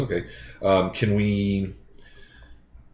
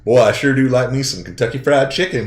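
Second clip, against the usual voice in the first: louder, higher put-on Southern accent; imitated Southern accent; 5 semitones higher, 7 dB louder than usual. Player imitating his English buddy winning the bet, loud Southern accent. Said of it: "This English guy goes".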